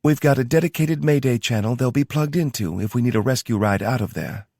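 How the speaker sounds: brisk briefing in a Southern twang; a Southern-twanged logistics officer; Tara's Southern twang. Acted. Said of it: "the twang in her accent".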